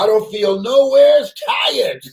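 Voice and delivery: high, loud, desperate cry; eager shout. Acted. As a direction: shout that he can still work.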